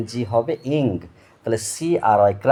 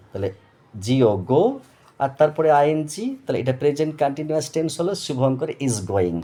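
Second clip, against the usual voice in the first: ordinary talk, nothing marked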